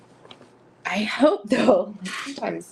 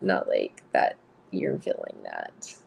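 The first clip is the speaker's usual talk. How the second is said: much lower and slower, a dragged-out quote; deep mock quote voice. Reading out a quote to viewers, low and slow mock voice. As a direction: drop the voice way down and draw it out. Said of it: "even that quote came out".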